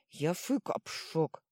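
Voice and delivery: slow lisped grumble; mushy complaint. A narrator's reading. Troll complains of his burnt fang, slow lisped whine. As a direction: complain slowly through a sore, full mouth. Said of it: "пожаловался тролль".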